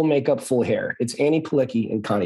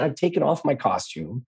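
normal talking voice

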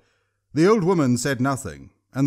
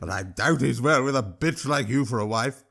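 troll sneering, a laugh in the voice; laughing troll insult. A narrator's reading. Throck taunts the old woman about her husband. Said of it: "Throck said".

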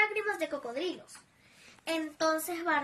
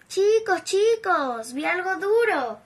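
aviso emocionado; 4 semitones higher, 7 dB louder, slower than usual. Acted. Oliverio avisa en el túnel.

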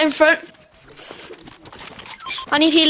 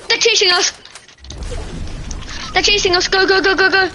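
urgent, higher panicked calls; being chased; 5 semitones higher than usual. Real, not acted. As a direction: hurry them along in a panic. Fleeing enemies in game.

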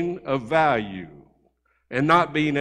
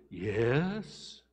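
put-on small, hushed child's hello; a timid kid picking up the phone, hushed; quiet little boy; 5 semitones lower, 11 dB quieter than usual. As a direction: answer softly like a shy little boy. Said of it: "real quiet and soft".

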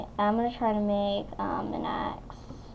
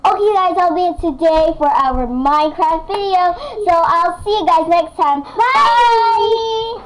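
louder, higher cheery goodbye; loud excited sign-off; 7 semitones higher, 9 dB louder than usual. Real, not acted. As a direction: wrap up the video with a loud shout.

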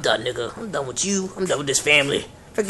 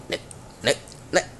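normal talking voice

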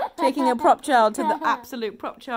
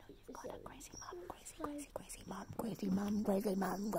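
very soft, whispered repetitive chant; playful whisper; 4 semitones lower, 17 dB quieter, faster than usual. Pretend. Mum telling baby about crazy mum, playful whisper. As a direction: whisper it over and over, tailing into a murmur.

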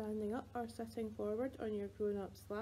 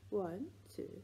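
ordinary talk

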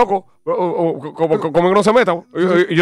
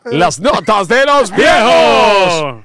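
announcer shout; 3 semitones higher, much slower than usual. Acted. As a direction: belt the segment title slowly. Announcing the segment title.

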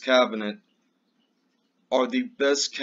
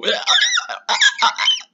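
high mocking laugh; 7 dB louder than usual. Mock laugh at the Democrats.

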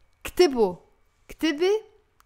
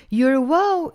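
normal talking voice

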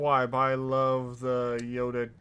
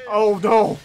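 alarmed shout; 6 semitones higher, 13 dB louder than usual. Real, not acted. Crash about to happen.

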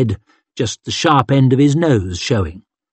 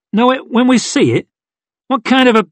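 much higher, disbelieving repeat; incredulous echo; 11 semitones higher, faster than usual. Acted. Yon challenges Craw's vague answer, high-pitched incredulity. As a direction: throw it back as an outraged question.

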